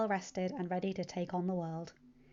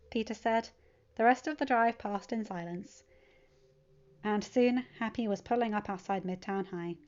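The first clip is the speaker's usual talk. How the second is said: ordinary delivery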